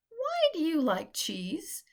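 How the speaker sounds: high, slow squirrel voice; a lively little squirrel asking slowly; squirrel character. A narrator's reading.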